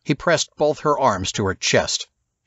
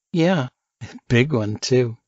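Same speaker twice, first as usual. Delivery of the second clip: a light laugh through the words; Alice answering Jones with a playful laugh; smiling laugh; 3 semitones higher than usual. Acted. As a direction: say it with an amused laugh. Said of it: "she smiled".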